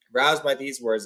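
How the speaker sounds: ordinary delivery, nothing marked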